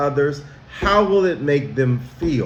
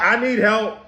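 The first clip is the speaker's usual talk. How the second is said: mock yell; 5 semitones higher than usual. Shown as a demonstration. Teacher acting a kid yelling for help. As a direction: play a kid blurting across class. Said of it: "Do you just yell out".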